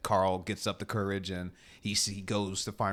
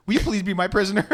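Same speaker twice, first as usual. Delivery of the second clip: fast, higher and louder, pushier; fast, higher, louder repeat of the plea; 10 semitones higher, 9 dB louder, faster than usual. Retold or imitated. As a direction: ask again, more insistent. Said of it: "And they're like".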